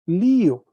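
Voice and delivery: lío said loud and clear, stress on li; Greek verb form. Shown as a demonstration. Teacher modelling the Greek form for the class, loud and clear. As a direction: spell out lío with stress on the first syllable, loudly. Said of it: "primera persona de la conjugación del verbo sería lío".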